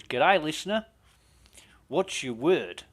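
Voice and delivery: bright Australian host taking a call; Australian accent. Retold or imitated. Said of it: "my Australian accent's shit".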